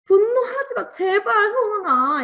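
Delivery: high, loud laughing plea; half-laughing, beg yourself by name; pleading with yourself. Retold or imitated. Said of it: "이렇게 하느라고".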